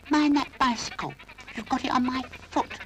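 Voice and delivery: very high squeaky doll voice. Acted. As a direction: complain in a tiny high voice. Doll's voice.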